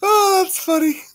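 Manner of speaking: very high, squeaky laugh-through words. Real, not acted. Streamer after a long laugh at a typo, still laughing. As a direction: barely recover from a laughing fit.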